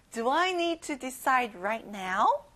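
very slow, carefully spaced question; slow model question. Shown as a demonstration. Teacher modelling a question for the class to repeat. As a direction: read it slowly for learners. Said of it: "같이 말해봐요".

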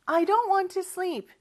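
whiny refusal. Acted. Boy refusing sleep.

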